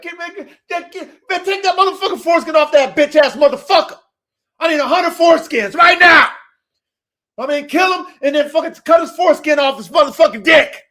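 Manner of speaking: high, loud, aggressive battlefield commands; King David. Acted. King David ordering his men on the battlefield, aggressive shouting. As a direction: shout furious orders like a warrior. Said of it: "that's how he was talking".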